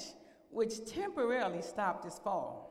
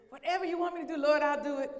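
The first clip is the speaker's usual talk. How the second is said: eager promising; 5 semitones higher, 6 dB louder than usual. Acted. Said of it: "you know, we promise".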